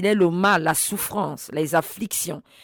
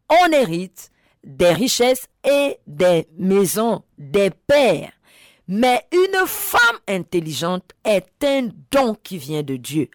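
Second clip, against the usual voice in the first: emphatic scripture repeat; 2 semitones higher, 4 dB louder than usual. Real, not acted. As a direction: hammer the verse home phrase by phrase. Je répète.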